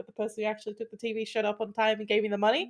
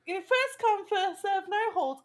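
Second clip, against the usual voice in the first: high, raised-voice exasperation; fed up, pitch jumping up; exasperated outburst; 8 semitones higher, 3 dB louder than usual. Acted. Furniture owner to people messaging for favours, exasperated.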